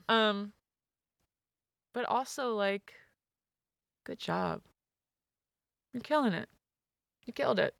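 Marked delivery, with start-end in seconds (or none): none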